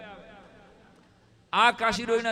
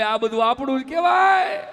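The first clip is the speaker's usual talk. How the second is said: mock proclaiming; 6 semitones higher, 9 dB louder than usual. Uncle's motto voiced loudly.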